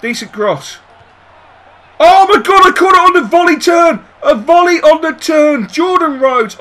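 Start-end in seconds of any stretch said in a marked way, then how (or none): 2.0-6.6 high, loud ecstatic shouting; excited shout. Real, not acted. Manager reacting to a volley, shouting excitedly. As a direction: shout it out in disbelief. Striker's volley nearly scores.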